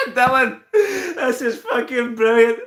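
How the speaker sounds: breaking up with laughter mid-sentence; laughing. Real, not acted. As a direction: talk through a fit of giggles. Streamer cracking up at comments.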